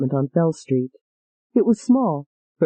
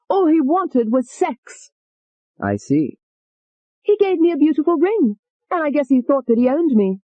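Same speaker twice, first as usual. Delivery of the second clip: Tony complaining to Doctor Keller, much higher, sassy; Tony, brash; 7 semitones higher than usual. Acted. Tony voiced through the session.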